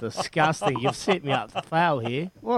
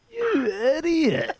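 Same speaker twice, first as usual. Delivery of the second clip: high, slow mocking laugh; drawn out, laughing teasing; mocking teammate; 12 semitones higher, much slower than usual. Teammate mocking his email in change room, high slow mocking voice. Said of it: "he laughed at me and he goes".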